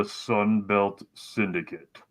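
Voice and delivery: slow, drawn-out villain announcement; evil Iron Man through a mask; supervillain voice. Pretend. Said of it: "it made you sound like a supervillain".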